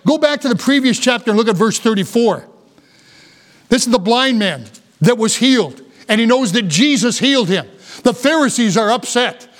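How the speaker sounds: ordinary delivery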